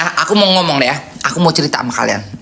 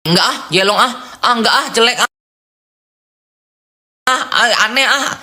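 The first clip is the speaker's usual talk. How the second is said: jawab ceplas-ceplos sambil tertawa, nada tinggi; blak-blakan mengejek; 5 semitones higher than usual. Retold or imitated. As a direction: tolak pujian dengan geli dan terus terang. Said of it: "Aku kayak gitu, guys". (menjawab teman dengan ejekan blak-blakan)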